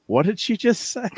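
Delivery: high-pitched baffled question; mock bewilderment. Retelling confusion at a relative's accent, mock bewilderment. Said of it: "I'm like".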